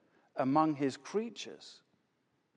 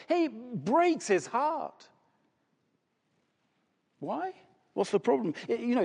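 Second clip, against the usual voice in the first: ordinary talk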